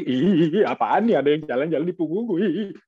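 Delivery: lightly voiced, fast ticklish squeal; ticklish buffalo. Buffalo feels something walking on its back, ticklish. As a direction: squirm as if tickled. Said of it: "kerbaunya geli".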